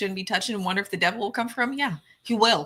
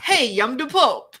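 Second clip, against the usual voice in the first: louder, slow, higher mock Chicago "Hey, I'm the Pope"; attempted Chicago accent; 5 semitones higher, 7 dB louder, slower than usual. Shown as a demonstration. Giving in to the request, a slow hammed Chicago Pope. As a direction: ham up a Chicago accent, dragging the words. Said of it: "That's as close you're getting".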